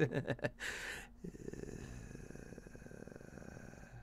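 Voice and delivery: quiet trailing laugh. Real, not acted. Painter laughing about the spill on the floor, amused. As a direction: laugh it off, letting it fade out.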